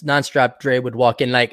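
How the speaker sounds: ordinary delivery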